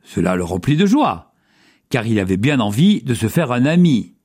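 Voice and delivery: plain reading voice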